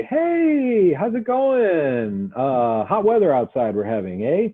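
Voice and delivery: high, slow, chirpy small talk greeting; hammed-up cheerful chitchat; mock breezy small talk. Shown as a demonstration. Counsellor mocking the wrong approach, high chirpy small talk. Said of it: "I'm not gonna walk over there and say".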